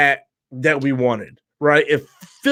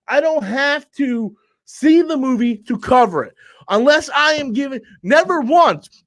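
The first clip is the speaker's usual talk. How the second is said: usual speaking voice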